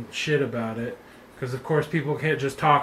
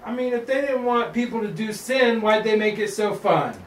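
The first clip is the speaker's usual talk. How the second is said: higher and louder, delighted; excited; 7 semitones higher, 5 dB louder than usual. Real, not acted. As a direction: sell the fun of sin. Sin is so fun.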